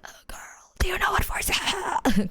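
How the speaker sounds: airy whisper with almost no voice; voice gone. Shown as a demonstration. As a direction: croak it out with a lost voice. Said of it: "'Cause my voice was so gone".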